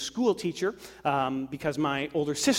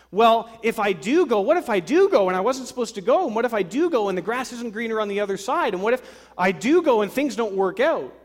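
anxious worrier; 7 semitones higher, 8 dB louder than usual. Retold or imitated. Acted fearful worrier.

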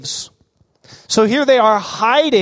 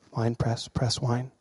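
wry aside; 8 semitones lower, 13 dB quieter, slower than usual. Real, not acted. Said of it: "You don't have to think too hard".

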